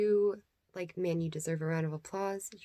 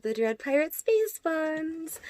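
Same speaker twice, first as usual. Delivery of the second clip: high, slow, grand pirate title; playful, hammed-up pirate host intro; mock pirate announcer; 8 semitones higher, 5 dB louder, much slower than usual. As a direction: announce yourself like a swashbuckling pirate captain, slowly.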